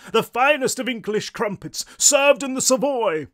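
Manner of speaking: loud, high, prim English correction; booming English ghost. A narrator's reading. Bald ghost correcting the short one, grand English. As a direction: correct haughtily in an English accent. What might have been an English accent.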